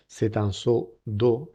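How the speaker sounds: seau d'eau stretched out slowly; very slow model phrase. Shown as a demonstration. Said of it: "model phrase".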